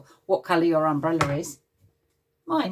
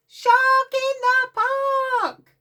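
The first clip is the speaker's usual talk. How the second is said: long, high shouted cry; big shout; 6 dB louder, much slower than usual. Acted. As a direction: stretch it out as a loud call. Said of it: "He shouts".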